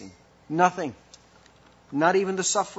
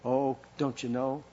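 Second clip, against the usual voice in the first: ordinary talk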